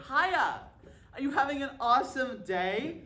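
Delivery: high, loud, bubbly cashier greeting; chirpy cashier. Retold or imitated. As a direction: mimic an overly perky fast-food girl. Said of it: "the girl behind the counter said".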